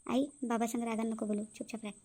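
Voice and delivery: soft urgent hush so father won't hear; whisper-hush her to keep quiet; hushed warning. Acted. Son hushing his mother in front of father, hushed.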